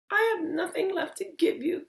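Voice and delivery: Lily begging the goblin for help; pleading Lily. Acted. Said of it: "she said".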